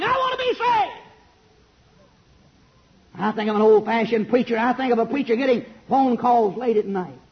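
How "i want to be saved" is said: high pleading cry, lightly done; a hint of a crying sinner. Preacher voices a sinner at the altar, high pleading. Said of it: "people walking down the aisle crying".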